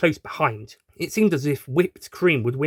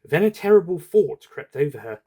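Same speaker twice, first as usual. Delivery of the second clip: plain reading voice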